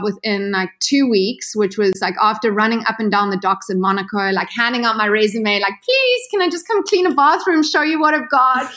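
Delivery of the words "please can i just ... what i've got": high, tearful plea; mock desperate begging. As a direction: beg the crew to let you clean. Begging yacht crews for work.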